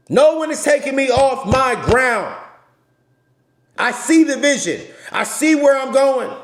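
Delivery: loud, high fired-up sermon delivery; loud passionate street preacher; passionate preaching. Real, not acted. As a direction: preach it at full force to your followers. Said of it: "I ain't yelling. I'm just passionate".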